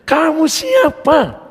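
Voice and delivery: high, very drawn-out question; retold challenge, slow and high; commanding demand. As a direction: stretch it out as a confrontation. Acted commanding question to shaman.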